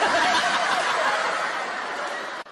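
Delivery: helpless laugh after the punchline; laughing at own joke. Real, not acted. Friend telling jokes, cracks up at his punchline.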